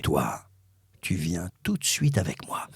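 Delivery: low, deliberate summons; intense director. Retold or imitated. Director picking out the young actor, low and commanding. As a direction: drop low and order them along. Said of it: "Il me regarde dans les yeux, il fait".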